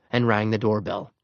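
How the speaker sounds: ordinary delivery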